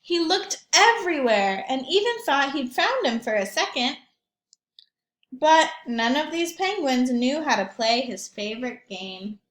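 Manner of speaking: put-on lively read-aloud for young children; story narration. A narrator's reading.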